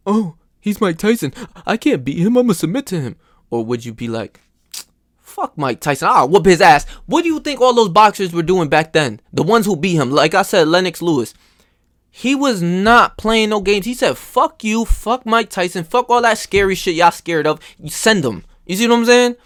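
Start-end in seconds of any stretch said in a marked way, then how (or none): 0.1-3.2 timid. Pretend. Timid mindset voiced. 5.2-6.9 defiant. Pretend. Defiant mindset voiced. 14.2-18.4 defiant. Retold or imitated. Lennox Lewis voiced defiant.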